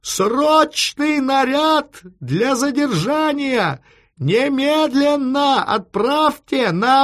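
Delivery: high, slow full-voiced yell. A narrator's reading. Chief ordering an arrest squad by telephone, shouting slowly. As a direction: shout an urgent order down the phone, vowels held. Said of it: "и закричал ещё громче".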